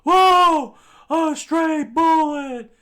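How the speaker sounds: very high, very loud falsetto scream; startled falsetto shriek, drawn out at the end. Acted. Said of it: "screamed out".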